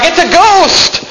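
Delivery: high, drawn-out frightened shriek; mock terrified cry. Disciple on the boat crying out in mock terror. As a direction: cry out like a spooked sailor.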